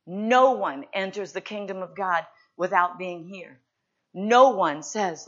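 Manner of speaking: loud, driving sermon voice; preacher pressing hard on the congregation; preaching at full force. Acted. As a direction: hammer the point home from the pulpit.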